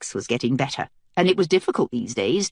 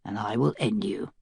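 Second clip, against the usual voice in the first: low voice; 7 semitones lower, 6 dB quieter than usual. Acted. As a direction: menace kept down under the breath. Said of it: "Mace said in a low voice".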